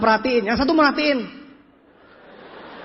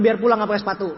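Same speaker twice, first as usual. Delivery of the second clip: usual speaking voice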